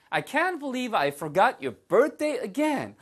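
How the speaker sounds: high, slow, dismayed self-reproach; exasperated disbelief. Acted. Teacher acts forgetting a birthday again, aghast. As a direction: wail it slowly at yourself. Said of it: "이런 식의 느낌인 거죠".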